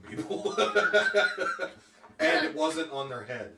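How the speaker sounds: loud, laughing as she talks. Real, not acted. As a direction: talk through a laugh. Laughing while explaining impalement.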